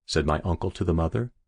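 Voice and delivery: ordinary delivery, nothing marked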